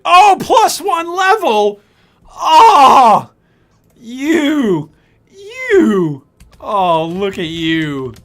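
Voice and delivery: very loud, high, drawn-out whoops of amazement; thrilled shouting. Real, not acted. Streamer seeing a plus one level drop, thrilled shouting. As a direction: cry out slowly, overjoyed at the prize.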